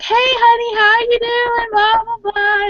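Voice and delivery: put-on high, cheery old-timer greeting; sweet post office man. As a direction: lightly mimic a friendly clerk calling you honey. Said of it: "he's always like".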